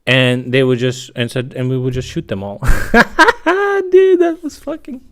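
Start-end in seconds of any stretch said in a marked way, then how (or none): 2.6-5.0 high, loud laughing exclaim. Real, not acted. Streamer reacts to the war story, laughing loudly.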